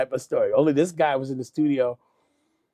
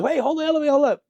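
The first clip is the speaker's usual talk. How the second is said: high-pitched imitation of a hail; calling out; 11 semitones higher, 3 dB louder, much faster than usual. Latecomer asks people to hold the elevator, called out. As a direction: call ahead to stop the doors.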